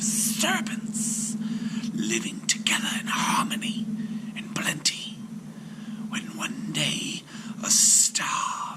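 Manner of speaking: slow serpent whisper, retelling a tragedy. A narrator's reading. Serpent recounts his family's death in a whisper. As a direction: breathe it out like an ancient snake. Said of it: "answered the serpent".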